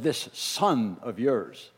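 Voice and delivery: cold sneer. Acted. Older son refusing to call him brother, slow and cold. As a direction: spit out This son of yours slowly. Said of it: "The older brother said".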